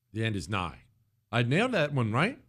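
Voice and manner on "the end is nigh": low, hushed prophet of doom; ominous doom voice. Shown as a demonstration. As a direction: intone it like a dire prophecy. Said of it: "Why do you have to say it like that?".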